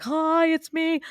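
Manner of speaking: high playful dog voice; cheerful dog voice. Pretend. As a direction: play the dog saying hello. Voicing the two dogs greeting.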